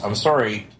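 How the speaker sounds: usual speaking voice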